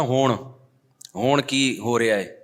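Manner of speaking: ہون drawn long as hoon; slow, long hoon vowel; mispronounced Punjabi hoon; wrong Punjabi hun. Shown as a demonstration. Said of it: "ایک لفظ بہت غلط بولتا تھا".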